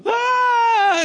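put-on sudden high shout of a hurt kid; retold scream. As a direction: yelp it out loud and high, a frightened ten-year-old. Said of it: "I screamed".

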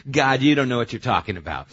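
loud, fast acted protest; emphatic insistence. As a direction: insist loudly as a cocky disciple. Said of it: "But Peter insisted emphatically".

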